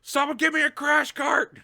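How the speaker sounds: high, loud frantic call for help; urgent doctor shout. Acted. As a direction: yell it like an ER emergency. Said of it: "one of the doctors is just like".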